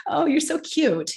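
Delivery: high, sugary cooing compliment; mock patronizing coo. Danish colleague in a business meeting, mock high patronizing coo. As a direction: coo it up high like talking to a child. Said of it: "People tell me".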